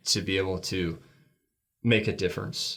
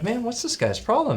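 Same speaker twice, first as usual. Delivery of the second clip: a higher, gruff-minded grumble of a rough carpenter; 14 semitones higher than usual. Retold or imitated. Carpenter's inner voice grumbling at a strange customer, higher pitched. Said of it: "the redneck, rough carpenter in me is like".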